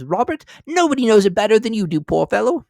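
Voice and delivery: high, loud, fast mother's voice; scornful wife. A narrator's reading. Wife to husband Robert, high fast pitying scorn. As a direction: rattle it off with pity and scorn. Mrs Cratchit's voice.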